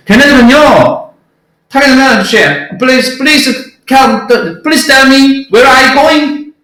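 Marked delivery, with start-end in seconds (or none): 2.8-6.5 mock Indian taxi driver English, higher and very slow; playful Indian English accent, higher pitch. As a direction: imitate an Indian cabbie's English, drawn out. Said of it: "이렇게 얘기해요".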